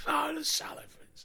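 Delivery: high, gushing cry of delight; delighted exclamation. Retold or imitated. Fan in a bar gushing about the Sullivans, high delighted cry. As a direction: recall blurting it out with glee. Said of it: "I just remember going".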